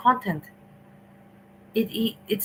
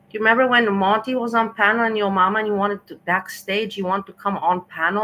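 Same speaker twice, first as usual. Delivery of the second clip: usual speaking voice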